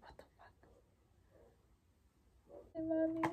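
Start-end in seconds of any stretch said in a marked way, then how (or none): 0.0-0.5 unvoiced, hushed retold what the fuck; whispered retold reaction. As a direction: breathe "What the fuck?" with no voice, like a secret. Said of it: "and I was like".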